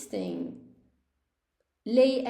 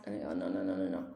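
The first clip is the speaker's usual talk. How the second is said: deep mock horror; 7 dB quieter, faster than usual. Real, not acted. Teacher groaning low at a common student mistake. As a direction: voice drops very low and soft, no no no. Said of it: "teacher rejecting the error".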